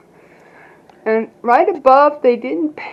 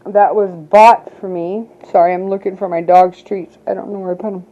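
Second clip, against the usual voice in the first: ordinary talk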